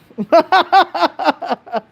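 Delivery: high, bursting laugh; real giggle. Real, not acted. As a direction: crack up at your own joke. Laughs at own imitation.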